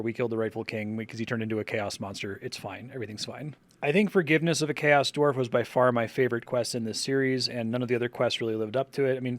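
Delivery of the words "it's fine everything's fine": flat ironic it's fine; deadpan sarcasm. Pretend. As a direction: say it with a resigned sarcastic shrug. Ironic shrug after killing king.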